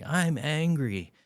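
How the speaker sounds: put-on a kid finally yelling his feelings out; retold angry shout. Dad retelling son shouting from car seat, loud slow shout. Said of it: "he finally just shouted really loudly".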